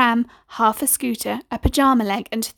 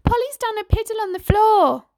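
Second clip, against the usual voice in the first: put-on higher, childlike telling-on voice; tattling little girl; 11 semitones higher than usual. Girl reports to mum about Polly, high tattling voice. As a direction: tell tales to mum. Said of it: "said a girl on the settee".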